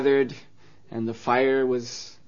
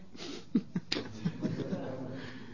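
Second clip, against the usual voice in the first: laugh; 4 semitones higher, 15 dB quieter than usual. Real, not acted. Storyteller laughing at the trick.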